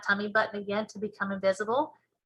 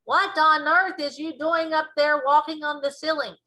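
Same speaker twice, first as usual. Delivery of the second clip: loud, higher scolding yell; yelling sister; 6 semitones higher, 5 dB louder than usual. A narrator's reading. Big sister finds boy walking on the ceiling, yelling.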